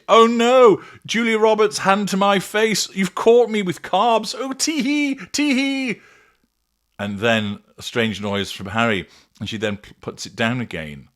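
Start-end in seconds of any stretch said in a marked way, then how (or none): 0.1-6.0 very high, loud falsetto mockery of a woman; mocking woman impression. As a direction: squeal it in exaggerated mock coyness, ending in tittering tee-hees. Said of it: "she can't just be eating it".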